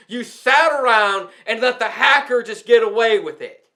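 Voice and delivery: ordinary talk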